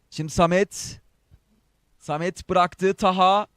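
ordinary delivery

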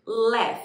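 "Laugh." said as "laf", ending on a clear F sound, no G; teacher modelling "Laugh." slowly and loudly, final F sound; "Laugh." pronounced like "laf", not with a G. Shown as a demonstration. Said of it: "Esse G é pronunciado com som de F".

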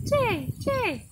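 high jay jay call; blue jay call. Retold or imitated. Teacher imitates the blue jay's call, high. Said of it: "It says".

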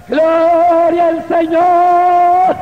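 very drawn-out, high, loud praise cry; grito de alabanza. Real, not acted. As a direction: hold the vowels long in an exultant shout. Climactic cry of praise to congregation. (grito de alabanza larguísimo y agudo)

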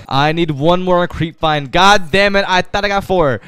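loud, high frustrated complaint; exasperated outburst. Real, not acted. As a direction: wail at being one short. One item short, exasperated.

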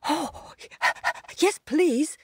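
high, eager yes please. Acted. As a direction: jump at the offer. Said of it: "leapt up eagerly".

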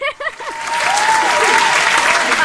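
loud, high delighted laugh; a host laughing over the win; big high laugh. Real, not acted. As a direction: burst out laughing with joy. Host laughs after congratulating.

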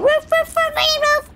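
high-pitched puppy woofing; dog bark. Pretend. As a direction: bark in a quick, high run. Playing a dog, barks.

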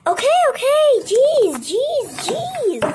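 high, whiny, exasperated protest; squealed, put-upon compliance; exasperated giving-in. Acted. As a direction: give in loudly to a nagging parent. Child gives in to nagging mother.

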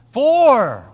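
loud, amazed echo of the answer; surprised. Real, not acted. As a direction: repeat it back in loud disbelief. Kid's surprising answer repeated.

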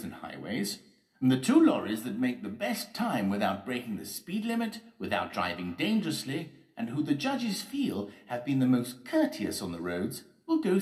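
loud, high public-speaking voice; ceremonial, projected character speech; mayor announcing rules. Acted. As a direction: declaim the rules to a crowd.

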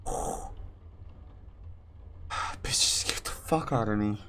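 soft tense whisper; hiding whisper. Real, not acted. Gamer hiding from the monster, whispering. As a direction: breathe the words so she can't hear.

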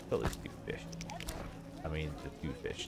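low, voiceless hush; whisper. Real, not acted. Gamer whispering to himself about two fish. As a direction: whisper it like a secret. Whispered sneaky game aside.

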